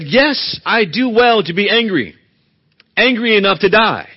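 loud, stubborn angry retort; defiant anger. Acted. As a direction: insist angrily on your right to rage. Jonah's angry reply voiced.

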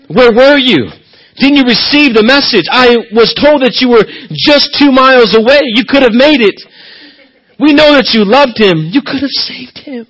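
lightly voiced hurt sister asking where he was; mock rebuke. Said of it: "it may seem like a rebuke".

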